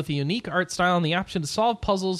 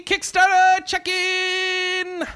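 high, drawn-out show-opener yell with held final notes; Japanese TV segment shout; 14 semitones higher, 5 dB louder, slower than usual. Shown as a demonstration. Podcast host announcing a segment like a Japanese show, high drawn-out shout. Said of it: "trying to do it like a Japanese segment".

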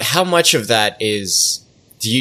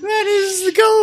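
put-on Cockney small boy voice. Keeps the boy voice.

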